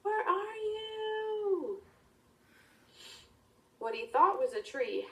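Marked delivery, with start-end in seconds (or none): 0.0-1.8 high, sustained singsong call; yelling. Acted. Boy up on a rock yelling for the frog, slow high call. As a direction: draw each word out high to carry far. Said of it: "yells for the frog".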